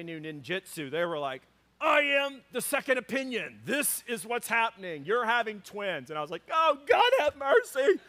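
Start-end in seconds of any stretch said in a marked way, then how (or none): 1.8-6.1 hammed-up, higher-pitched pronouncement; imposing doctor. Second doctor declaring the verdict to parents, mock imposing. 6.5-8.0 high, loud plea to heaven; hammed-up wail of despair; mock dismayed cry. Father hearing twins are confirmed, mock dismayed cry.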